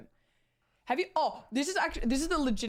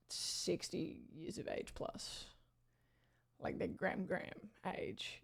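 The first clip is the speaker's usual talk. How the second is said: hushed, drawn-out whisper; whispered aside; 11 dB quieter, slower than usual. Real, not acted. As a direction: drop to a confidential whisper. Hushed aside about elderly royalists.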